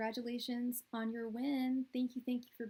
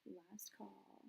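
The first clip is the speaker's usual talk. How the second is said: whispered, much softer; hushed last call; 16 dB quieter than usual. Pretend. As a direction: let it fade to a near whisper. Bar-style last call, voice dropped.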